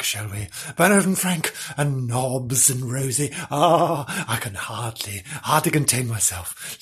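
plain reading voice

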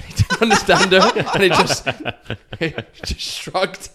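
laughing high and loud mid-story; can barely get the words out for laughing. Real, not acted. Cracking up telling a funny story.

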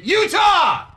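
loud toast shout. Real, not acted. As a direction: yell it like a cheer.

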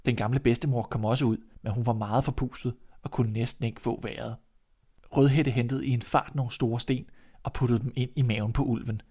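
ordinary delivery

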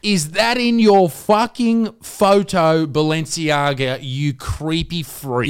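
drawn-out, high, loud exasperation; outraged rant. Acted. Co-host berating Balenciaga over the photo, outraged. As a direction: stretch each word out, incredulous and loud. Comic outburst at a brand's photo.

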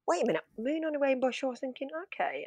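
hushed, hurried inner monologue, retold; musing to oneself. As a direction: voice a private double-take under your breath. Retelling own first puzzled thought.

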